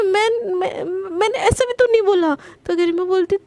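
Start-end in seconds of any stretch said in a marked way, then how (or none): 0.0-2.3 flustered whimper. Acted. Priyanka stammering, near tears.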